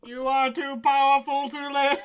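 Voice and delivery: loud robot impression. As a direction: stress on powerful, booming. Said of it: "Yes, exactly like that".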